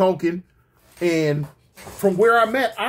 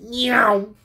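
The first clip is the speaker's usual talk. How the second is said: higher, drawn meow; quick cat yowl impression; imitated cat; 4 semitones higher than usual. Cat sent flying, imitated.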